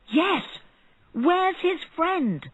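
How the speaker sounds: high, slow crowd voice; excited crowd echoing. A narrator's reading. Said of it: "They were very excited".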